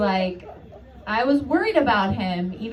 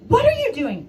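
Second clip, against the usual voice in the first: mock startled question; 13 semitones higher, 4 dB louder than usual. Nurse voiced in mock scene.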